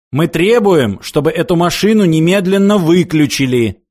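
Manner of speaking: high, forceful declaration; loud demand. A narrator's reading. Philosopher demanding the machine be switched off, forceful.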